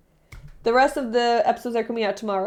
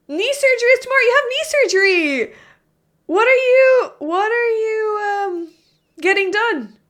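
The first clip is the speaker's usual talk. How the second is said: high-pitched, louder, real surprise; surprised concern; 10 semitones higher, 6 dB louder than usual. Real, not acted. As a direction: pipe up high, asking after their surgery. Reacting to viewer's surgery news.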